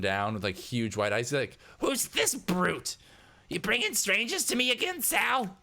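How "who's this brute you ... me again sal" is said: high, agitated hacker voice; jumpy hacker Eddie, accusing. Acted. Hacker confronting employee over a stranger, high agitated accusation. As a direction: demand answers about the intruder, pitched way up.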